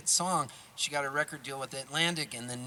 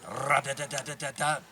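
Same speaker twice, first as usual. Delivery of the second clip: imitated drum beat. Shown as a demonstration. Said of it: "that does that drum beat".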